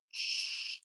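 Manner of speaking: a soft, breathy shh; whispered shush. Real, not acted. Owner quietly hushing her dog: whispered shh. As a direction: hush the dog gently.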